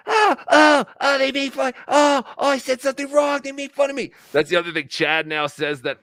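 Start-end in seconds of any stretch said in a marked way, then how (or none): 0.1-4.1 high-pitched, loud mock wail; mock whining. Host mocking people upset at being made fun of, high whining. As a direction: whine it like a wounded crybaby. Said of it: "They go".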